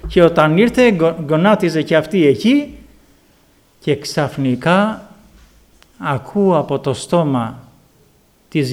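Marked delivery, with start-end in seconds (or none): none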